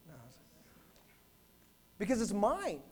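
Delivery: normal talking voice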